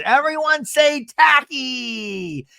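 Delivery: high, slow, sing-song photo prompt; mock photographer's sing-song; photo cue. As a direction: lead the group photo like a cheery host. Photographer cue for group picture.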